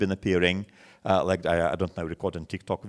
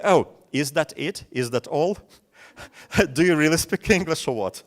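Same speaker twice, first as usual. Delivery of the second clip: higher, louder, a teasing jab with a laugh at the end; mocking disbelief; 7 semitones higher, 5 dB louder than usual. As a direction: poke fun at their English like a smug friend. Friend mocks your English skills.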